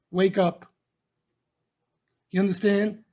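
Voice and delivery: ordinary talk, nothing marked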